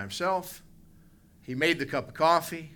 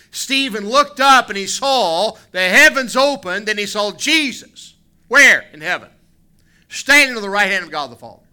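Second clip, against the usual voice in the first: much higher and louder, fervent sermon; preaching at full force; 8 semitones higher, 11 dB louder than usual. Acted. Preacher rousing the church about Jesus leaving, fervent and loud. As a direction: lift it and drive every phrase home.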